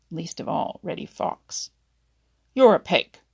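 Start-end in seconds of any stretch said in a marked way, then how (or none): 2.5-3.2 loud jeering insult; a fox taunting a bigger animal. A narrator's reading. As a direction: sneer it out loud at the stranger. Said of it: "taunted Reddy".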